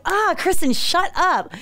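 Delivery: high, pleading exasperation; exasperated self-scolding. Retold or imitated. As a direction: groan it at your own big mouth. Scolding herself in retold thought.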